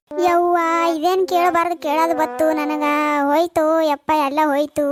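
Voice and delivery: drawn-out theatrical wail of despair; wailing lament. Acted. Mother-in-law hears of her husband eloping, wailing. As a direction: lament slowly, like keening over a ruined honour. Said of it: "ಯಾಕೆ ಹಿಂಗ್ಯಾಕ್ ಅಳ್ಳಗತ್ತೀರಿ?".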